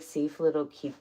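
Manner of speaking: usual speaking voice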